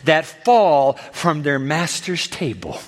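slow, word-by-word reply; measured, emphatic quotation; deliberate reading. Acted. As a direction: spread the words out slowly. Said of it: "in the Greek it reads like this".

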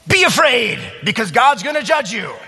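hammed-up threat of judgment; ominous warning. Preacher playing himself warning Bob, mock doom shout. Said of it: "the good news that God wants to share".

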